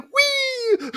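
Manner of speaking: very high, far-off squeal with a laugh; inner glee. Retold or imitated. The inner voice after first success, giddy laughing squeal. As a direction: let out a giddy childlike squeal. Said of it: "the voice inside your head was like".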